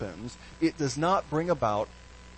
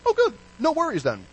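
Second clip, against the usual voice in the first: high, breezy Jack Sparrow quip; silly pirate voice; 13 semitones higher, 5 dB louder than usual. Retold or imitated. Pirate shrugging off a deadly vow, high and breezy. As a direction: toss it off lightly like a careless pirate. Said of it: "in his own silly way".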